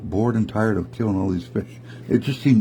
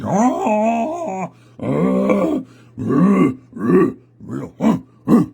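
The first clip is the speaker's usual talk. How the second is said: loud, high drawn-out bear roar then short growls; noisy bear roaring, breaking into grunts; 10 semitones higher, 4 dB louder, much slower than usual. A narrator's reading. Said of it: "making all this noise".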